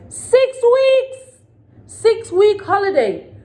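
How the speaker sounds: high, loud disbelieving cry; shrill indignant outburst; outraged shout. Real, not acted. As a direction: yell it in real outrage, dragging it out. Outraged at six-week holiday.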